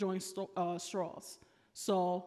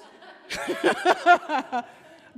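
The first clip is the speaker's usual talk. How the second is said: long high laugh falling away; loud laugh; 10 semitones higher, 12 dB louder than usual. Real, not acted. Preacher laughing at how far Jonah ran, loud laugh. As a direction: let the laugh roll out.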